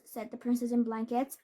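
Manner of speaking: usual speaking voice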